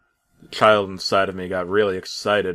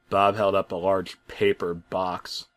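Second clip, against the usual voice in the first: ordinary delivery, nothing marked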